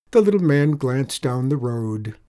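usual speaking voice

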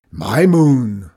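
very loud, booming roar. A narrator's reading. Farmer outdoes both rivals claiming the moon, roaring. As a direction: roar it, topping every other voice. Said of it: "roared Zeke".

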